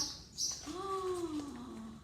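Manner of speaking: sharp surprised gasp; dramatic gasp. A narrator's reading. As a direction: gasp in mock alarm.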